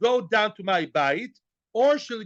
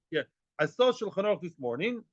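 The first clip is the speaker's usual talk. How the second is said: ordinary delivery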